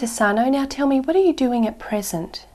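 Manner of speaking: usual speaking voice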